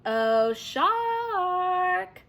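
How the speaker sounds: loud, high sing-song call; teasing boy calling the shark; mock calling out. A narrator's reading. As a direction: call through cupped hands. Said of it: "Monty called through the cupped hands".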